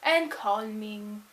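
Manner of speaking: soft, slow, drifting as the calm music is described. Acted. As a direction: let the voice sink low and soft. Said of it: "soft and slow and calming".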